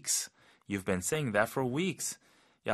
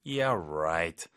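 low, drawn-out sarcasm; skeptical, stretched "right"; sarcastic; 4 semitones lower than usual. Shown as a demonstration. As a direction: drop the pitch and doubt them. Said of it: "이 말투가 좀 약간 비꼬이는 톤이죠".

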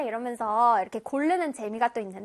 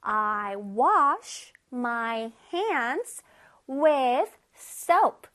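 very slow read-along; 4 semitones lower, much slower than usual. Shown as a demonstration. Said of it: "우리 친구들 차례".

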